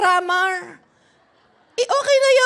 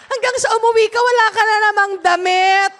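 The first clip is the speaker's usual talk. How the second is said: normal talking voice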